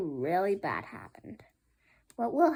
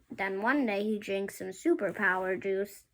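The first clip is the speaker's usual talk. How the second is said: ordinary delivery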